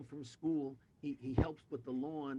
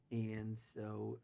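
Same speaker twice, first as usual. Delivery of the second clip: normal talking voice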